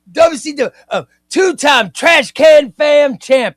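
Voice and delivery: loud, bragging read of the viewer's words; mock champion boast. Reading a viewer's brag to the stream, shouted hype. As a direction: hype up the two-time title like a ring announcer. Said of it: "say it again".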